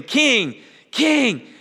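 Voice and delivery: high, loud cries of King, rising each time; crowd calling out. Retold or imitated. Preacher voices the Palm Sunday crowd, loud praising cry. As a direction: call it out like a cheering crowd.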